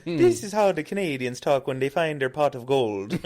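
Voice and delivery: high, loud Irish-tinged fake Canadian; leprechaun-like Canadian accent. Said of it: "now you're talking Irish".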